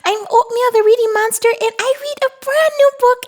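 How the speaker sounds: high, fast, bubbly; fast excited host voice; excited outro. Acted. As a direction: cheer them on to subscribe. Upbeat channel outro.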